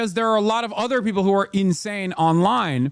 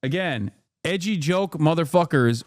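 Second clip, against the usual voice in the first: ordinary talk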